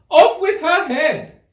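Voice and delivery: high, slow, loud shouted order; furious shout. A narrator's reading. Furious queen at croquet, loud shout. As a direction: scream the sentence in a rage.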